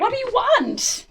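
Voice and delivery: high, loud yelled demand; player yells. Acted. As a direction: yell it as you lunge. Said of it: "you yell".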